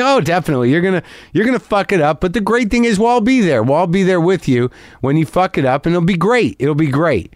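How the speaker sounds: high, upbeat fan, fast at first then beaming; excited earnest fan. Retold or imitated. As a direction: sell a doomed future as great news. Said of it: "confidence and excitement".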